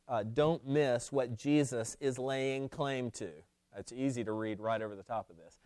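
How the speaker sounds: usual speaking voice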